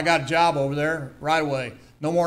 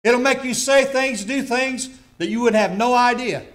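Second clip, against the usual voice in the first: fervent warning; 8 semitones higher, 5 dB louder than usual. Real, not acted. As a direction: press the danger home. Continues warning.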